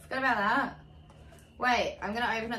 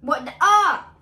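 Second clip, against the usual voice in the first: startled yelp; 7 semitones higher, 11 dB louder than usual. Real, not acted. Startled by item.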